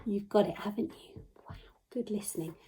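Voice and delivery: ordinary talk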